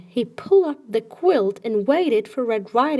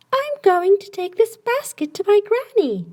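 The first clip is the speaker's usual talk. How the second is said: put-on higher, sweet little-girl reply; little girl's voice; 10 semitones higher, 3 dB louder than usual. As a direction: answer brightly like a small child.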